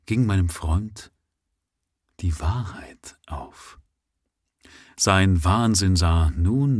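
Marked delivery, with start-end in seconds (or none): 2.2-3.8 whispered. A narrator's reading.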